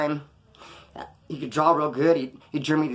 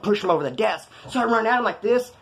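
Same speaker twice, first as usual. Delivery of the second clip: plain reading voice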